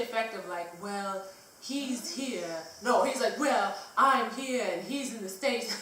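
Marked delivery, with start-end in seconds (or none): 0.8-2.6 very drawn-out fake British accent; badly faked British drawl. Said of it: "my really bad British accent". 3.4-5.7 slow, higher mock British accent; hammed-up, drawn-out bad British. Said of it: "my really bad British accent".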